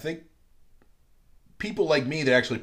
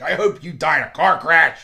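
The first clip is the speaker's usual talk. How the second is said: vicious mock threat; 5 semitones higher, 9 dB louder than usual. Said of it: "I get vicious when I get mad".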